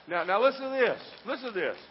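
loud, high, impassioned sermon climax; full-force preaching. Real, not acted. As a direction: build to a shouted, certain declaration.

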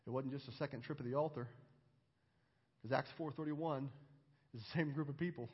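low, hushed sermon voice; solemn preaching. Acted. Preacher teaching the Holy Spirit's power, solemnly. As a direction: bring it down soft and grave, with long pauses.

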